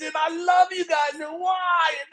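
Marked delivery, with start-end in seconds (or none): 0.1-1.1 high, urgent begging cry; pleading. Retold or imitated. Joseph begging his brothers from the ditch, high pleading. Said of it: "He's pleading and begging". 1.4-1.9 high, wailed plea; pleading. Retold or imitated. Joseph begging his brothers for rescue, high pleading. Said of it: "He's pleading and begging".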